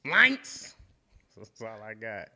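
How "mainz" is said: high, loud mock-German bark; aggressive fake German. Guest topping off the German gag, aggressive fake German accent. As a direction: fire off rhyming nonsense in a German accent. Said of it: "they don't talk that aggressive".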